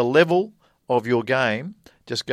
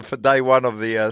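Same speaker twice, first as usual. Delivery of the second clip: normal talking voice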